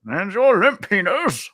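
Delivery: high, loud theatrical declaiming, drawn out; viral clip impression. Co-host mimicking the arrested man, high booming indignation. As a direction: proclaim it like an outraged orator.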